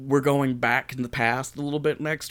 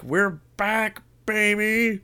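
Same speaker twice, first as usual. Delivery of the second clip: higher, bright whoop of a return; excited cheer; 9 semitones higher, much slower than usual. Acted. Host greeting listeners after a break, excited cheer. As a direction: throw it out like a big comeback.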